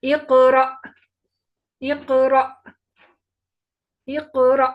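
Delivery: इक़रा loud, with a sharp jerk on the stopped hamza. Shown as a demonstration. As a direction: bounce the sound in इक़रा, loud. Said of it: "झटके से पढ़ेंगे". (इक़रा को झटके से, ज़ोर से)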